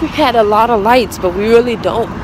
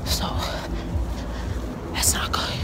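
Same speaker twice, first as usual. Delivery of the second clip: whispered; 9 dB quieter than usual. Real, not acted. Worried aside while cold outside.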